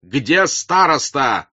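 loud, drawn-out demand; frenzied shout. A narrator's reading. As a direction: shout it furiously, stretching the words. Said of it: "неистовым голосом кричал он".

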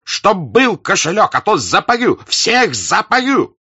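loud, high threatening yell; furious shout. Acted. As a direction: bellow the threat, dragging the last words. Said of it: "вдруг закричал Денисов".

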